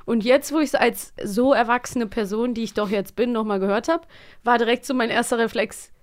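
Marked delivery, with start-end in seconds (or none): none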